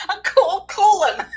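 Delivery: high, loud, slow words through laughter; helpless giggles while retrying the phrase; cracking up. Real, not acted. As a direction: barely get it out for laughing. Cracking up over own slip.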